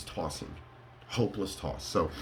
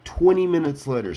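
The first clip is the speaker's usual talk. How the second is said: emphatic time-card announcement; 8 semitones higher, 7 dB louder than usual. Acted. Stressing the long wait for help, emphatic announcement. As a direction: belt out the time skip, high and loud. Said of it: "Let me say it".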